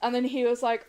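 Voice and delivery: ordinary talk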